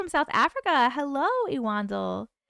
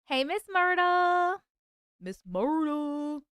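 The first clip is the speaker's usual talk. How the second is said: drawn-out name; 4 semitones higher, slower than usual. Real, not acted. Drawn-out greeting to a viewer.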